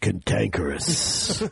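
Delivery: Cantankerous dropped very low and slowed; deep drawn-out voice. Shown as a demonstration. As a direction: stretch Cantankerous deep and slow. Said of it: "No, I'm tankerous".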